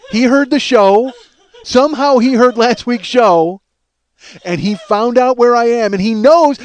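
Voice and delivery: loud, high, hammed-up alarm; panicked rant. Husband convinced the possum knows he's away, panicked. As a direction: drag out each dawning realization at a near shout. Reenacts his panicked paranoid thoughts.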